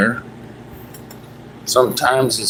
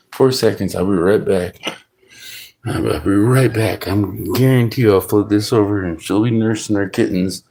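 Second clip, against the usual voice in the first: usual speaking voice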